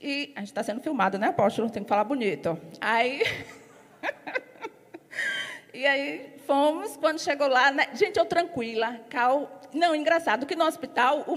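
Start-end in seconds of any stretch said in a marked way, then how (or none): 4.9-5.7 a sudden high-pitched giggle. Real, not acted. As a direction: giggle at yourself, caught off guard. Laughs after "tem que falar bonito.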